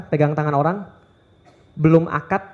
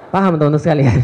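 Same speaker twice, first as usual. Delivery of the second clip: laughing aside; 3 semitones lower, faster than usual. Real, not acted. Laughing after joke.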